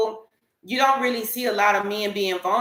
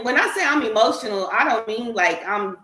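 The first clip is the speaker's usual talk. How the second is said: usual speaking voice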